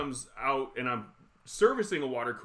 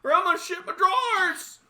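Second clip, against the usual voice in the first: put-on loud, very high old-lady holler; high, hammed-up elderly warning; urgent old lady; 6 dB louder than usual. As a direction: imitate an old woman urgently calling out. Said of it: "She's like".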